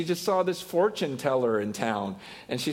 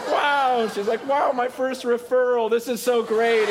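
excited; 7 semitones higher, 4 dB louder than usual. Retold or imitated. Lady thrilled at referral.

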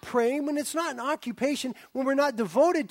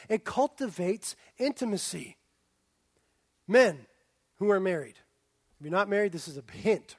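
normal talking voice

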